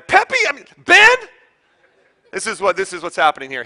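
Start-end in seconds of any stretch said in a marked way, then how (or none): 0.0-1.2 high, loud mock yelling of the boys' names; imitating mad mom. Mom, upset with her son, yelling through the brothers' names, mock angry shout. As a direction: call the sons like an upset mother, hammed-up. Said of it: "throw the dog in there".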